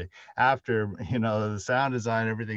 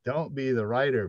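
loud, laughing mock warning, higher; playful warning; 5 semitones higher, 9 dB louder than usual. Real, not acted. Host jokingly warns listeners off writing, loud and playful. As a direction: warn them off with a grin you can hear.

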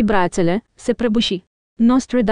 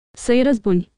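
plain reading voice

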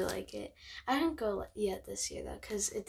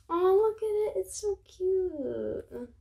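high, louder coo of delight; 8 semitones higher, 4 dB louder, slower than usual. Real, not acted. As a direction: swoon over something adorable. Cooing at the snow mouse picture.

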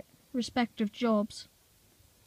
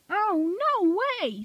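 high, slow no way; amazed; 9 semitones higher, much slower than usual. A narrator's reading. Ben hearing Bill's story, amazed. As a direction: drag it out in high disbelief.

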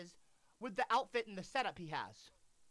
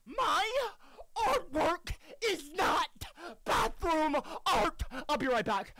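crying scream; 3 dB louder, slower than usual. Real, not acted. Screaming crying about artwork.

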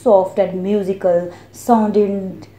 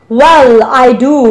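loud, higher-pitched retort; a little rude; 5 semitones higher, 8 dB louder than usual. A narrator's reading. Boy contradicting the voice about his name, a little rudely. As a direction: answer back with mild cheek. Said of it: "retorted Diamond a little rudely".

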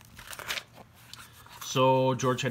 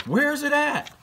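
put-on much higher, louder excited kid voice; eager boy George; 6 dB louder, slower than usual. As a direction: blurt it out, eager for treasure. George asks about the treasure.

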